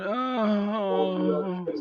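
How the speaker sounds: long, high moan, softly drawn out; elf's moan. Acted. Game master voicing the injured elf left on the floor, moaning. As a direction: moan like a put-upon elf. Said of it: "and moaning".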